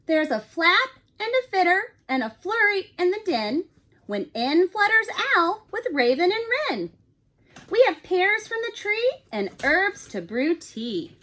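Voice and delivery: animated picture book read-aloud; reading to children. A narrator's reading. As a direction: read it like bedtime story time for a class. Teacher reads picture book aloud.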